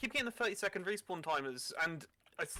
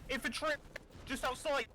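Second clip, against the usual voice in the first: calling out warning; 7 semitones higher than usual. Real, not acted. Calling out enemies to teammates.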